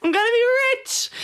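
high, fast and giddy; excited squeal. Acted. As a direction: squeal it like you just won. Gloating about earning money per mention.

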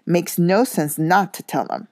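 plain reading voice

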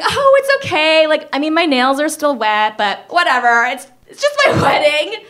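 high, forced laugh-cry, pretending it's fine; smiling through tears. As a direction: fake brightness over tears, breaking into a laugh. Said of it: "smiling with, like, tears streaming down my face".

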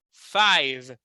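five ending cleanly on the V, no vowel after; correct pronunciation. Shown as a demonstration. Teacher modeling the right five, ending on V. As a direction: close five on a crisp V, no "i". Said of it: "a palavra toda termina no V".

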